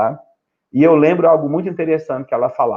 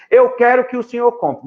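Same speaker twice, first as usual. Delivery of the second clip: higher, mock pushy kid voice; demanding child; 8 semitones higher than usual. Pastor imitates a child demanding a gift, whiny and demanding. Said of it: "Toda vez que seu filho exigir".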